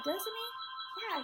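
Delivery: usual speaking voice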